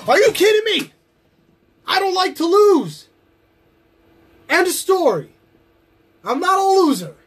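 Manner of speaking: furious shout. Acted. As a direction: explode at the promoter for mocking him. Furious rant about losing.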